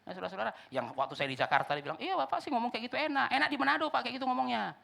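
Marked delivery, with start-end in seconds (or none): 2.0-4.7 higher-pitched grumbling church member; high-pitched mock complaint; mimicked complainer. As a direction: imitate a listener protesting to the pastor. Voices complaining Jakarta listeners.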